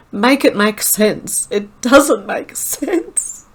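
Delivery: slow, high plea dissolving into laughter; real laughing disbelief, voice climbing; helpless laughter. Real, not acted. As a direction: drag the words out while cracking up. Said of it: "I'm crying from laughing".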